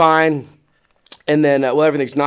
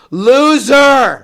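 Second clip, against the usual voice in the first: high, very loud drawn-out jeer; heckler's yell; 12 semitones higher, 11 dB louder than usual. Retold or imitated. Kid right in front heckling the presenter, loud yell. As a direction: holler it across the room like a heckling kid.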